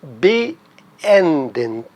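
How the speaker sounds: beenden in pieces, be, then a stressed EN-den; stress on middle syllable. Shown as a demonstration. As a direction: pause after be and hit EN in beenden. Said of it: "E N no meio".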